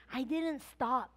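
ordinary talk, nothing marked

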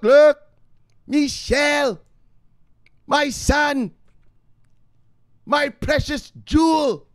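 high, slow, loud mock-mother cry; hammed-up doting mother. Mock mother calling to the precious son, hammed-up adoration. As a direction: draw out each endearment in a high put-on voice.

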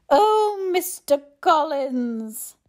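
high, loud, drawn-out lament; doleful. A narrator's reading. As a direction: sigh out the name dolefully, very slowly. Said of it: "In a doleful voice".

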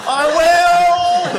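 high frantic yell while fleeing; goblin shout. Acted. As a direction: shout it high as you run. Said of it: "he says, rolling down the hill".

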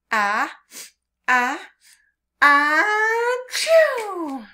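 hammed-up sneeze; big mock sneeze. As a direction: build up ah, ah, then a high loud achoo. Ant sneezes in the pepper.

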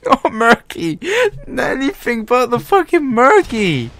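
high, strained pleading whine. Real, not acted. Opening cases, begging in a high strained whine. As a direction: beg the case not to give murky. Begging the case for good skin.

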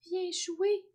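high, happy call to play; overjoyed invitation. A narrator's reading. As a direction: eagerly invite the boy, pitched high. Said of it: "tellement heureux qu'il put à peine parler". (invitation joyeuse, voix haute)

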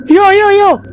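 loud, high cries of alarm; startled yelling. Real, not acted. Gamer jumps at a sudden intruder, startled yelling.

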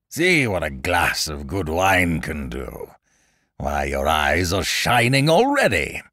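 slow, lightly acted royal gloating; gloating king. A narrator's reading. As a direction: jeer with mock delight, drawing it out. King gloats over drinking jester.

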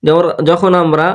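ordinary talk

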